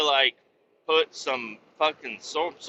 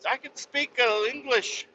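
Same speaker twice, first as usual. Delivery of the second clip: much higher put-on voice, exasperated; squeaky protest; 12 semitones higher than usual. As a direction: insist you speak English, squeaky. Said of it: "I'm like".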